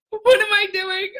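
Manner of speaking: high, frantic cry of doubt; panicked self-talk. Retold or imitated. At the sink, doubting her new life, panicked. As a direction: squeak the question out in panic. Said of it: "And I was like".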